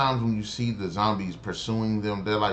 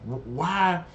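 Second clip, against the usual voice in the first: higher, strained, cut-off exasperation; exasperated disbelief; 9 semitones higher, slower than usual. Retold or imitated. Reviewer retelling his outrage at the zombies, sputtering. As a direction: sputter at the screen, too annoyed to finish. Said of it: "I'm like".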